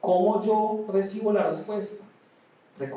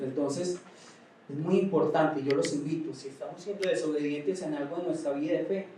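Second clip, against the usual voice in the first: ordinary talk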